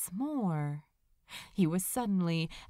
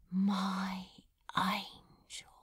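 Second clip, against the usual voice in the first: breathy hushed whisper of love; whispered confession to his angel; 3 semitones lower, 4 dB quieter, slower than usual. Acted. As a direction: breathe it to him, barely voiced. Crowley finally says his line.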